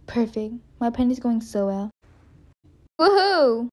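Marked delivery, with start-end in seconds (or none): 3.0-3.7 high, loud whoop of glee; loud cheer. Acted. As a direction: celebrate out loud without thinking. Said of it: "That was a bit loud".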